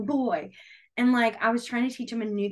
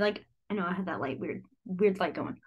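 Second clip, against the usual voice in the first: normal talking voice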